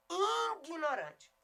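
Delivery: IGNORANTE as "INguinorante", nasal start stretched high and loud; wrong nasal first vowel. Shown as a demonstration. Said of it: "com I N".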